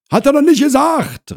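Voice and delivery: very high, loud yell; shouting back. Acted. Wife answers husband inside the flat, shouting high. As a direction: holler it back over the shoulder. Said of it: "rief Frau Krause".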